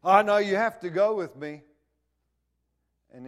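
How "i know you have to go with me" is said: loud, higher insistent shout; a parent yelling at a reluctant kid to come to church. Retold or imitated. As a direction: bark it at the kid who won't come.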